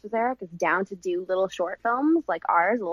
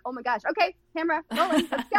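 higher and fast, rushing to roll camera; a sudden scramble to start shooting; hurried director; 8 semitones higher, faster than usual. Retold or imitated. Director rushes the crew to film the child's tears, fast and high. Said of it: "I'm like".

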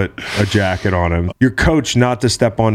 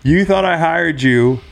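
wrestling promo; 7 semitones higher than usual. Acted. Hyping henchman promo.

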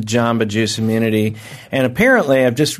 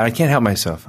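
ordinary delivery

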